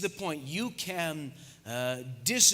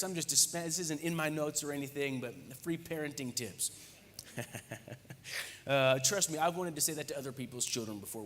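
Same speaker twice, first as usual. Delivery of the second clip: normal talking voice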